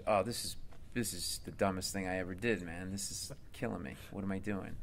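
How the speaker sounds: quietly despairing inner voice; despairing mutter. Retold or imitated. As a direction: mutter it to yourself like you've ruined everything. Despairing inner voice, quitting regret.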